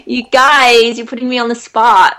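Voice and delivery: Californian accent. Shown as a demonstration. Actor teasing co-hosts for putting her on the spot, Californian accent. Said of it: "That's pretty good".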